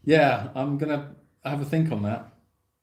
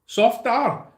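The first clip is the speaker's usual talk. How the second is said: wrong Scottish try; 9 semitones higher, 4 dB louder than usual. Shown as a demonstration. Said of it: "No, that's wrong, isn't it?".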